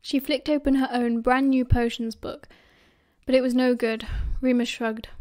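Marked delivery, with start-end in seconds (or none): none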